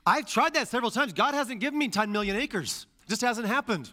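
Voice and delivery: high, loud comic protest; mock complaint. Preacher joking about claiming Joshua's promise, mock complaint.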